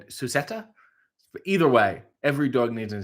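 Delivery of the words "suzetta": "Suzetta" said with an S at the start, stress on "zet"; Dakota Kai pronunciation. Retold or imitated. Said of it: "or as Dakota Kai would say".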